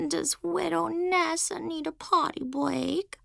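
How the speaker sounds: put-on slow, high babytalk taunt; whiny baby talk, mocking; annoying baby voice. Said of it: "in her most annoying baby voice".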